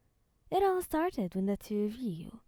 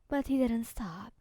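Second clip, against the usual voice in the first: ordinary delivery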